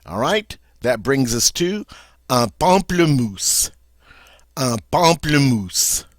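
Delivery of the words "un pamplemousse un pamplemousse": "pamplemousse" as pahm-pluh-MOOSE, slow; slow, relished French "pamplemousse"; French pronunciation. Shown as a demonstration. Teacher savouring "un pamplemousse" for the class. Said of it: "that's how we say good old grapefruit".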